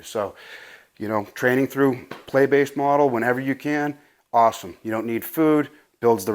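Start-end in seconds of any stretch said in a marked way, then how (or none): none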